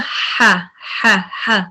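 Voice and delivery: हा said soft and breathy; weak हा. Shown as a demonstration. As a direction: let हा out gently with the breath flowing. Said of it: "वो वीक है".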